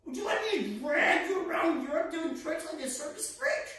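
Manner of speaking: high-pitched sneering father's voice; mocking father. Father ridiculing his son's dream of music, mocking high voice. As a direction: voice a scornful parent, pitched well up. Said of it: "he would say".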